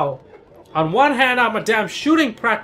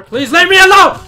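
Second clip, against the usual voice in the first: desperate plea; 10 semitones higher, 13 dB louder than usual. Real, not acted. Begging the officer to stop attacking, desperate cry. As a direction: go from murmur to a desperate shout.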